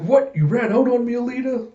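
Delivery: high-pitched, incredulous protest; shocked outcry. Retold or imitated. York calling after Alita as she leaves, shocked outcry.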